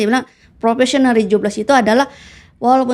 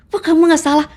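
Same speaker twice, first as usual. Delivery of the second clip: high mock surprised question; retold mentor; 8 semitones higher than usual. Quoting her mentor, a high surprised question. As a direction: ask it up high in disbelief. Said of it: "sampai mentor saya bilang".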